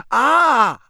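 loud, high cry of shock; cut-off sentence bursting into a yell; startled yell. Acted. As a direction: yelp as if suddenly drenched. Balloon bursts on Ron's head.

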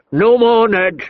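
angry warning. A narrator's reading. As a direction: snap a warning at him. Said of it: "he warned".

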